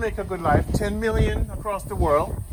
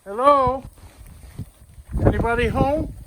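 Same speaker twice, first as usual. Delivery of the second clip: calling down a hallway; 6 semitones higher than usual. Real, not acted. Calling into empty building.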